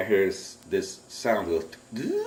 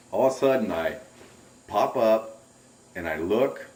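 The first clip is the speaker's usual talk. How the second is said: normal talking voice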